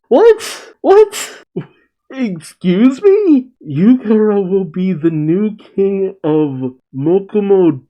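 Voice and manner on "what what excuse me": much higher, louder squeaks of "What?"; can't believe what you just read; slow, stunned "Excuse me?"; shocked disbelief. Real, not acted. Shocked by Carrot made king.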